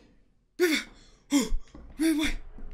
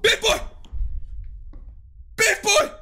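taunting shout; 8 semitones higher, 3 dB louder than usual. Real, not acted. Taunting the monster loudly.

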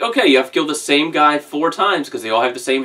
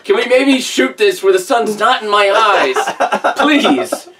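much higher, slow, pleading with a laugh in it; whiny actor complaint; 7 semitones higher, slower than usual. Retold or imitated. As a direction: beg the director like a sun-blinded actor. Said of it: "you can see him going like".

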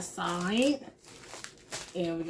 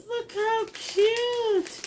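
1 delighted; 12 semitones higher, much slower than usual. Real, not acted. Laughing at cute candy.

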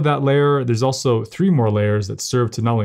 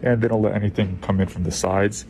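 muffled behind respirator mask; through a face mask; 2 semitones lower, 3 dB quieter than usual. Real, not acted. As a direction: mouth covered by mask. Seen 4: Speaker in phone video wears N95 mask over mouth throughout.

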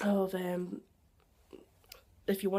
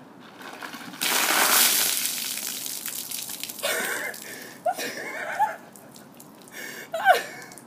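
shrieking scream. Real, not acted. Ice water dumped, screaming.

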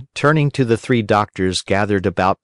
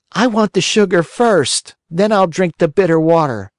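put-on whiny puppet boy; 9 semitones higher than usual. A narrator's reading. Pinocchio refuses the fairy's bitter medicine, whining. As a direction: refuse like a stubborn child.

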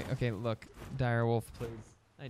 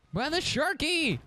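mock indignation; 13 semitones higher, 3 dB louder, slower than usual. Repeats startle, mock outrage.

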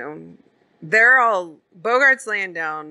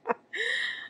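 high cackling laugh; imitating the old man's high laugh; old man's high cackle; 8 dB quieter than usual. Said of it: "the old dude just starts to laugh".

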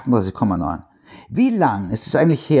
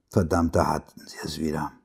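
plain reading voice

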